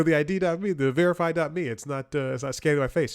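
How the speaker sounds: loud repeating. Retold or imitated. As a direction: spell it out loudly. Retold call, repeating loudly.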